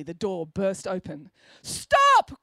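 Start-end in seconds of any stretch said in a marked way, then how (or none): 1.6-2.4 very high, loud shout of stop; dramatic cry. A narrator's reading. Friday bursts into the classroom with a loud cry. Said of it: "cried Friday".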